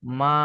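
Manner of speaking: মা starting low and rising; second tone. Shown as a demonstration. Teacher showing the tone that means fiber, rising second tone. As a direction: lift the pitch through a long মা. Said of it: "আবার যদি আপনি second tone বলেন".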